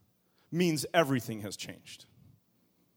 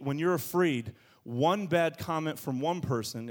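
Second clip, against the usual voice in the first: usual speaking voice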